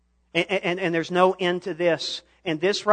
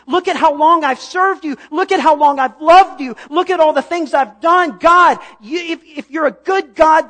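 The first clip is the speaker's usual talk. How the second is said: pleading, accusing outcry, much higher and louder; demanding God; 8 semitones higher, 9 dB louder than usual. Retold or imitated. Believer accusing God after a loss, a high anguished shout. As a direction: shout the complaint at God, rising to a cry. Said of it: "we say".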